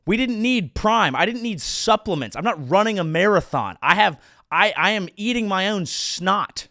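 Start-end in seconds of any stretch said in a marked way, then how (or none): none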